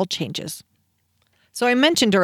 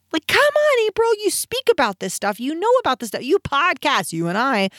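much higher, exasperated "come on, April"; exasperated self-scolding; 7 semitones higher than usual. Real, not acted. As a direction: scold yourself, fed up and incredulous.

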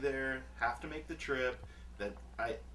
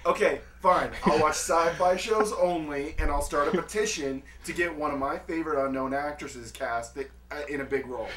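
louder, higher defiant declaration; mock defiant; 4 semitones higher, 14 dB louder than usual. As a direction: protest like a petulant geek.

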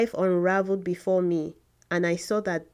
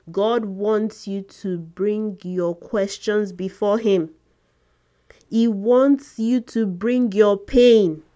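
forceful preaching; 2 semitones higher, 6 dB louder than usual. Acted. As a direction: drive it home to the congregation.

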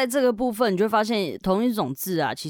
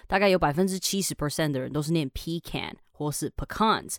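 ordinary talk

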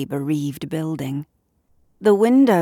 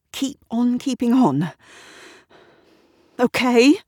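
higher, agitated, forcing herself together; angry self-talk; 7 semitones higher, 4 dB louder than usual. A narrator's reading. Woman trying to collect herself at the mirror, angry and strained. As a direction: scold yourself through rising panic. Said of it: "she says to herself angrily".

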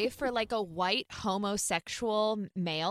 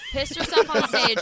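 laughing; 11 semitones higher, 6 dB louder than usual. Real, not acted. Laughing while retelling gossip.

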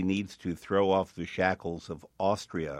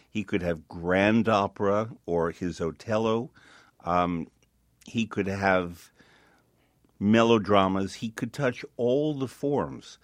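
ordinary delivery

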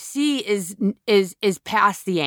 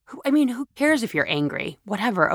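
usual speaking voice

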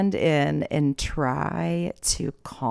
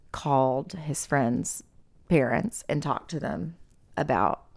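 usual speaking voice

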